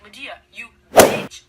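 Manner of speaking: loud, slowed mock-furious yell; loud furious messenger; angry messenger yell. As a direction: bellow the accusation, hammed up. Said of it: "he's like".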